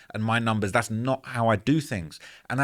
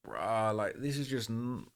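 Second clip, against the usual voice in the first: dismayed mutter; 10 dB quieter, slower than usual. Retold or imitated. Retold thought, dismayed.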